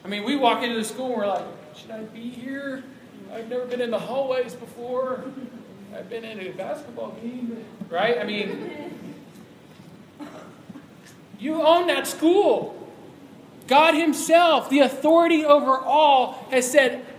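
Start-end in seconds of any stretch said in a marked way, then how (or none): 1.8-7.6 quiet, higher, unsure voice; timid student. Retold or imitated. Timid student entering school. 11.4-17.0 slow, loud, higher preaching; building emphasis. Real, not acted. Preacher building to God's command.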